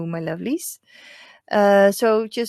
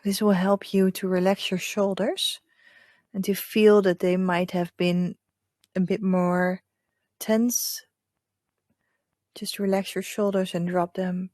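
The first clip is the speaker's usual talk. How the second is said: hushed guide leading breath and visualization; hypnotic meditation voice. Acted. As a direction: lull them gently into calm. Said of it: "that's my hypnotic voice".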